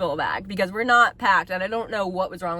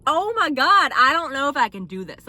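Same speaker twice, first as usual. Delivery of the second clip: higher, louder anxious cry of doubt; mock-panicked Oh my God; 4 semitones higher, 5 dB louder than usual. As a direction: voice the moment you think you can't go on. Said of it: "I was like".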